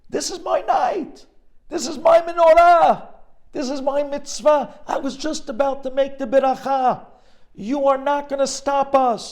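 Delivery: high, loud pleading cry; frantic boy shouting at soldiers; desperate boy. Retold or imitated. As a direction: beg them to stop with everything you have. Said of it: "he says".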